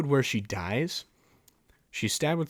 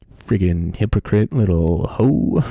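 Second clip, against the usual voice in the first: louder and slower, each word hammered; escalated insult; 4 semitones lower, 12 dB louder than usual. Real, not acted. Vlogger topping the insult at Gi-hoon, slow loud hammered jab. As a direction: drag it out, even louder.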